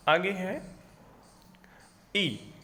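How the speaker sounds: usual speaking voice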